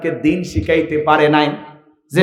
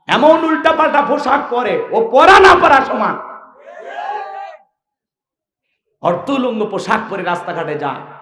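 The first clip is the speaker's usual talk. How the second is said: higher-pitched, emphatic sermon delivery; full-force preaching; 8 semitones higher than usual. Acted. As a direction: preach it out to the crowd, punching key words. (জোরালো ওয়াজের ভঙ্গি)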